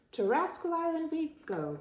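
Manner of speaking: high-pitched parrot cry. A narrator's reading. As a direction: cry it out high, as a pirate's bird. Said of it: "cried the bird".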